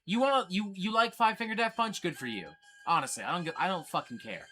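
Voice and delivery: normal talking voice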